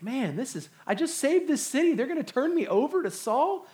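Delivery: high-pitched disbelieving complaint; incredulous dismay. Retold or imitated. David complains that Keilah will betray him, disbelieving.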